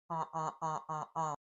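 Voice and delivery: nasal vowel with nose pinched, muffled and soft; nose plugged. Shown as a demonstration. Teacher checks the nasal vowel with pinched nose, muffled. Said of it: "by plugging my nose and going".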